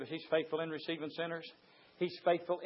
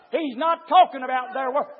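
ordinary delivery

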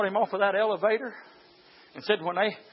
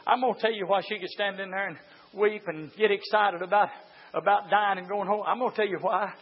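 normal talking voice

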